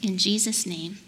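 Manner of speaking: measured prayer in short phrases; simple repeat-after-me prayer with long pauses; leading prayer. Real, not acted. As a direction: pray line by line, leaving room to repeat. Leads children in repeat-after-me prayer.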